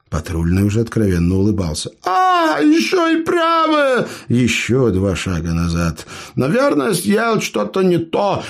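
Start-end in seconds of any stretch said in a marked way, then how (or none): 2.0-4.1 very high, slow hammed-up sob; fake crying. Dwarf fakes more ear pain. 6.3-8.4 apologetic. A narrator's reading. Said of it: "извиняющимся тоном оправдался карлик".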